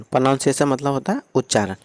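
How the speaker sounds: usual speaking voice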